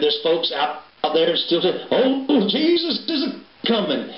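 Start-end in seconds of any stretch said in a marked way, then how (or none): 1.9-4.1 high, scornful mock voice; mocking doubter. Preacher mocking folks who say Jesus isn't coming, high scornful voice. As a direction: sneer it like the doubters would.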